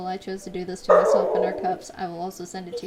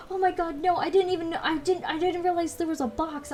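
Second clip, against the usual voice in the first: high, flustered customer; flustered apology; 8 semitones higher than usual. Retold or imitated.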